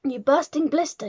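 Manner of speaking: high, loud insult; angry yell. A narrator's reading. Trunchbull explodes at Wilfred's counting, yelling. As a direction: explode at a pupil. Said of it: "yelled the Trunchbull".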